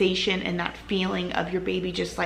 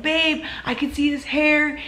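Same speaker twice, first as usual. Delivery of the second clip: retelling his excited cheer, pitched higher; emotional husband; 6 semitones higher than usual. Retold or imitated. Wife retelling husband cheering her through labor, excited and higher. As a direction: voice the husband's eager relief, a touch higher. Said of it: "He was getting emotional".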